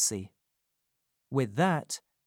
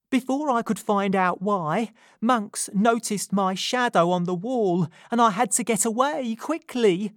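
put-on higher, louder, urgent young woman; Nancy risking her life telling Rose the truth, urgent and louder; 9 semitones higher, 6 dB louder than usual. A narrator's reading. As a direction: confess it as if your life depends on it.

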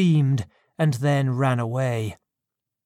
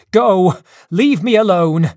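loud, higher order to leave; loud dismissal; 4 semitones higher, 8 dB louder than usual. A narrator's reading. Victor driving the monster away, loud shout. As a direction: shout him out of the room.

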